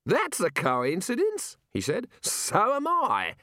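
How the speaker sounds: put-on high, slow, booming old wizard; hearty old wizard. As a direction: savour the bracing air grandly. Arch-Chancellor deadpan.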